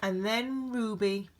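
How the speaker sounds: ordinary delivery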